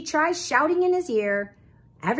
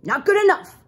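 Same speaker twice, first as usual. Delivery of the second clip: loud, high, dismissive uncle voice; raised, blustering character voice; grumpy Uncle Phil; 8 semitones higher, 10 dB louder than usual. A narrator's reading. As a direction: reject it forcefully as a grumpy old groundhog.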